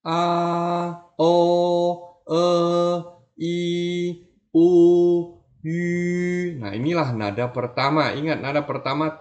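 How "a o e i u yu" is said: a o e i u yu each held high and level; high level vowel drill; first tone vowels. Shown as a demonstration. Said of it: "nggak bisa ya".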